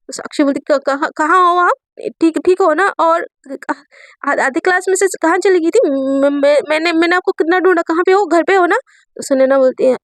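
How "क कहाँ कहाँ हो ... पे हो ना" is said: higher, stammering, breathless questions; panicky worry. Acted. Student panicking over her missing teacher's call. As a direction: stumble over the words in a panic. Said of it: "एकदम बहुत ज़्यादा panic होती".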